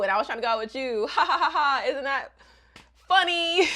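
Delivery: hammed-up ha ha ha; mock laughing. [name] imagined confessing the mix-up to Agatha, fake laughing. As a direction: fake laugh through the words.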